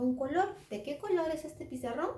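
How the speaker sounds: ordinary delivery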